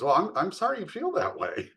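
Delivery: high-pitched, breezily dismissive fake sorry; lilting insincere apology, hammed up; mock apology. Partner brushing off the one they pinched, mock apology.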